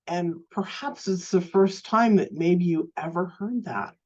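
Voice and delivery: ordinary talk, nothing marked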